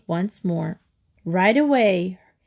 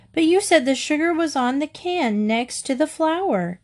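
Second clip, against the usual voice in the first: put-on higher-pitched child's accusation; accusing; 7 semitones higher than usual. A narrator's reading. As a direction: protest as if it's the other person's fault. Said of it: "Katy accused".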